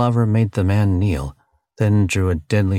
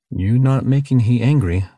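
alien broken English; 5 semitones higher, 3 dB louder than usual. Acted. Tuk Tuk's broken English warning.

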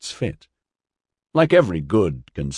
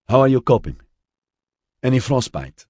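put-on higher-pitched northern Mediterranean accent; 6 semitones higher, 4 dB louder than usual. A narrator's reading. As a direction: speak up in a strong Mediterranean accent. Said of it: "Her voice had a strong northern Mediterranean accent".

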